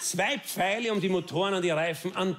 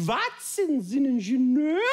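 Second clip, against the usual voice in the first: voice from the bushes; 7 semitones higher than usual. Retold or imitated. Stranger in the bushes calling to the engineer. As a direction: call from the undergrowth in mock awe. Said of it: "wie jemand aus dem Unterholz ruft".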